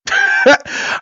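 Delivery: high squeaky laugh. Real, not acted. Laugh during mock seller.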